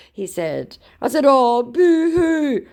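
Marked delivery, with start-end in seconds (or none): 1.3-2.7 high, drawn-out fake pity; loud, hammed-up teasing wail; mock crying taunt. Taunts the upset scammer.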